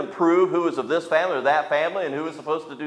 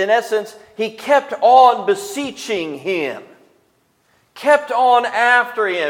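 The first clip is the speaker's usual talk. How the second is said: loud, raised preaching voice; fervent sermon build, higher and louder; preaching at full force; 7 semitones higher, 7 dB louder than usual. Acted. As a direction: drive the point home from the pulpit.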